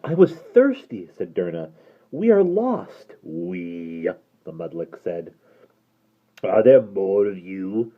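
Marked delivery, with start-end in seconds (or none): 0.0-1.1 put-on high, loud pleading girl; a lost girl defending herself; young girl pleading; frightened girl voice. Frightened girl answers creature. 2.1-3.2 put-on high, loud pleading girl; a lost girl defending herself; young girl pleading; frightened girl voice. Derna pleads. 3.2-7.9 low gravelly creature voice; hideous creature speaking low; deep creature voice. Acted. Creature questions Derna.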